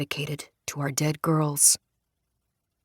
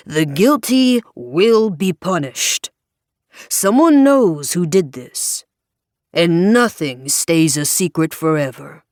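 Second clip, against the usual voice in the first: louder, slow, thundering pulpit sermon; worked-up Southern Baptist preacher; 5 dB louder, slower than usual. A narrator's reading. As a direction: hammer every phrase like a fired-up preacher. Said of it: "best impersonation of a Southern Baptist preacher getting really worked up".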